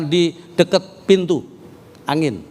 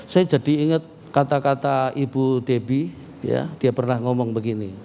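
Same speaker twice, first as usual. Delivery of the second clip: usual speaking voice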